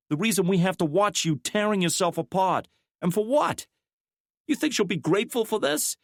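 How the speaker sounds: a woman unloading her frustration; McKnight's rising anger. A narrator's reading.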